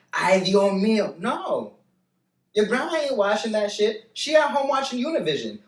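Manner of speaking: ordinary delivery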